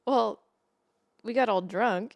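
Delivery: ordinary delivery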